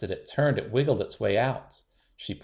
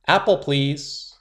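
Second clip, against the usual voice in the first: louder, slow and deliberate; loud and clear; 5 semitones higher, 7 dB louder, slower than usual. A narrator's reading. Girl ordering juice from teacher, loud and slow. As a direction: say it proudly and clearly. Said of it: "Willow said".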